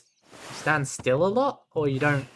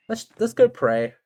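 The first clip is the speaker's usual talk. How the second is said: mock eager; 7 semitones higher, 8 dB louder than usual. Real, not acted. Eager joking call.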